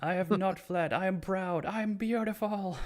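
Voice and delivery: high, pompous bragging voice; high-pitched mock grandiose boast; hammy boastful Power. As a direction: strut through it like a vain diva. Parodying Power's boastful lines.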